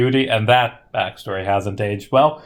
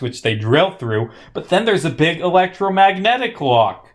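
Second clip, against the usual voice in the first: ordinary talk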